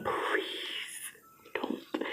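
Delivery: barely voiced, desperate begging; whispered plea. Real, not acted. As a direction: let the voice vanish into a plea. Begs listeners not to abort.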